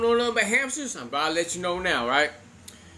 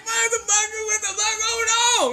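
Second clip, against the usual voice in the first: mock-distressed outcry, pitched way up; retold freak-out; 13 semitones higher, 3 dB louder than usual. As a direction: panic like a horrified kid. Kid freaking out, acted.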